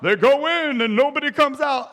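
high, loud freaked-out telling; mock panic. Preacher telling of the warlock's trees, mock panic.

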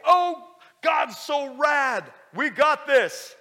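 high, loud, slow triumphant shout; gung-ho believer. Acted. Preacher acting the formerly faithful Joshua, gung-ho shout. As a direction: whoop it out like a fired-up dude. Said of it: "the kind of dude that was faithful".

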